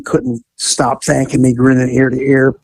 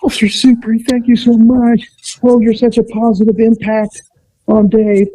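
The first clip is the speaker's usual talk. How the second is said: grateful parents; 8 semitones higher than usual. Retold or imitated. Dave's parents thanking warmly.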